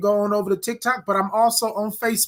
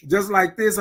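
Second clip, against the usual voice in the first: normal talking voice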